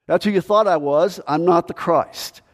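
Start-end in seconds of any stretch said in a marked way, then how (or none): none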